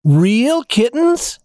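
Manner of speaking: slow, high, wondering question; amazed doll voice. A narrator's reading. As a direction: marvel at it like an excited doll. Dolls amazed.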